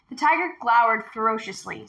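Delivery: plain reading voice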